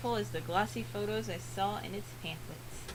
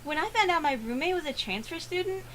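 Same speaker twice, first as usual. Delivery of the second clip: higher, bright chatter; bubbly girl voice; 6 semitones higher, 4 dB louder than usual. Acted. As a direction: gush like an excited roommate. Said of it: "how I'm going to voice her".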